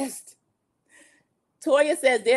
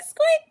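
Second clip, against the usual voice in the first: very high, loud imitation dolphin squeak; corny dolphin squeak; 5 dB louder than usual. Contessa mocking dolphin talk on the trip, corny loud squeak. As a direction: squeal it like a cheesy dolphin toy. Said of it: "starts making this corny squeak".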